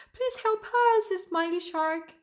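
high-pitched storybook plea for help; pleading cry. A narrator's reading. Trapped fish beg Smiley Shark, high pleading cry. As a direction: plead high, easing off toward the end. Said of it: "cried the fish".

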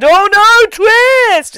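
high, loud fake surprise; mock shock. Host mocks the picture reveal at the end, fake shock. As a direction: gasp out an overdone horror reveal.

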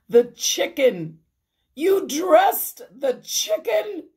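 loud, very high incredulous question; acted horror, pitch climbing; aghast disbelief. A narrator's reading. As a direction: build to a high cry of shock. Mrs Rogers aghast at dressed chicken.

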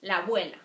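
la abuela run together as labuela; linked vowels. Shown as a demonstration. Teacher to students, linking the two A sounds. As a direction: link la and abuela into one. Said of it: "You just link those two".

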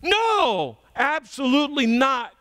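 sharp high angry no; cursing denial. Acted. Peter to his accusers, a high angry denial. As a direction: Peter swearing he never knew him. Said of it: "he starts to curse".

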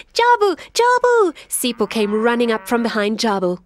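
high, fast and breathless; excited call. Acted. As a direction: shout the news. Sipo runs up calling.

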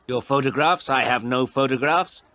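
mildly affronted woman; indignant denial. Acted. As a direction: throw the question back indignantly. Woman rebuffs the caller.

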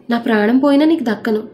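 ordinary delivery, nothing marked

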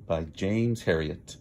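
ordinary delivery

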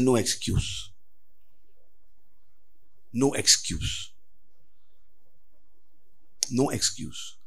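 low, soft, slowing warning; quiet warning. Acted. Preacher quietly warning the church, low and soft. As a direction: let it sink in softly.

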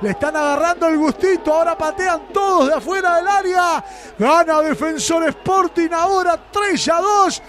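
breakneck goal commentary. Real, not acted. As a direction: savour the goal at full voice. Excited post-goal recap.

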